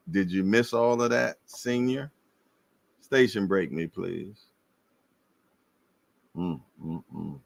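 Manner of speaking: usual speaking voice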